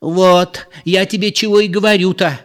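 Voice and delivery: high, loud exclamation; exclaiming. A narrator's reading. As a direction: burst out, vindicated. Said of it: "воскликнула Зоя".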